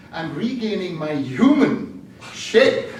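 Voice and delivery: usual speaking voice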